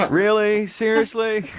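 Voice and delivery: high, loud, amazed questions; stunned and half laughing at it; astonished disbelief. Real, not acted. Host to guest Kevin after his confession, astonished.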